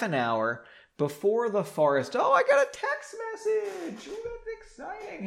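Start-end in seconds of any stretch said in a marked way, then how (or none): 2.2-5.3 very high, squealy fake thrill; mock excitement. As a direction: gush like a giddy teen. Mock excitement over a text.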